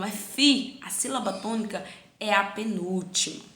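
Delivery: usual speaking voice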